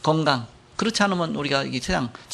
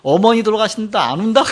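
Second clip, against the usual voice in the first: raised, higher-pitched retold scolding; mock scolding; 5 semitones higher, 4 dB louder than usual. Villagers scolding children for not crying at mother's funeral, mock scolding. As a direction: imitate villagers berating dry-eyed mourners. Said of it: "막 욕하는 거예요".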